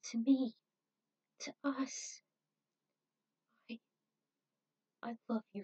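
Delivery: a hint of a cracking, pleading voice; desperate pleading. Julia pleads with unconscious Spike, cracking voice. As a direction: beg him to come back, breaking. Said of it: "her voice to crack under the desperation".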